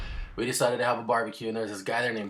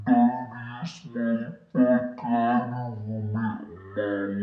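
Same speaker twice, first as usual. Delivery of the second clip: put-on Bubba's accent; 2 semitones higher, 7 dB louder, slower than usual. Mimicking Bubba's accent while he cheers a friend at a barbecue. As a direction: ham up Bubba's Southern accent, stretched out slow. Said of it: "that's the best I can do his accent".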